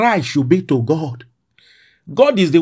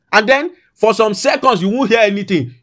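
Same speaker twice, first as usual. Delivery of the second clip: normal talking voice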